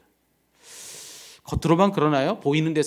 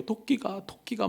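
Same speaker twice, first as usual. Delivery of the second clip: put-on child crying; 10 semitones higher, slower than usual. Child sobbing quoted.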